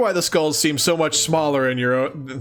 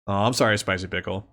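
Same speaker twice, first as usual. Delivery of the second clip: lower, much softer comforting words; sympathetic; 7 semitones lower, 4 dB quieter, faster than usual. Real, not acted. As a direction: gently soothe a viewer with a migraine. Comforting chatter with migraine.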